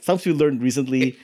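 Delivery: ordinary talk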